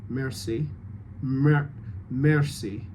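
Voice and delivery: "merci" with a soft R; softened R. Shown as a demonstration. Coach offers actor an alternative, softened R. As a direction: ease off the R in "merci". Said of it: "you could just soften the R".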